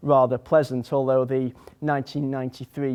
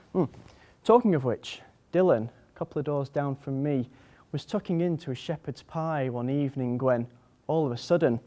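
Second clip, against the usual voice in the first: ordinary talk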